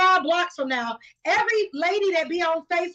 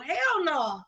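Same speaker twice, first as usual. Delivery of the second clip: normal talking voice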